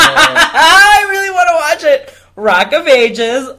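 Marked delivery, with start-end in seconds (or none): 0.0-2.1 loud, high words through laughter; laughing. Real, not acted. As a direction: burst out laughing as you speak. Laughs over the unwanted choice.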